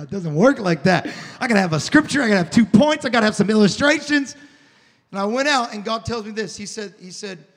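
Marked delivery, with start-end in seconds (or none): 0.0-4.4 loud insistent objection to God; heated loud pushback; loud protest. Acted. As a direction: argue back hard, listing your rules. Protesting to God, arguing back.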